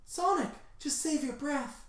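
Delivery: soft, high falsetto plea; hushed squeaky distressed heroine; Blaze. Acted. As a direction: gently urge a dying friend in a high voice. Blaze hushes dying Sonic.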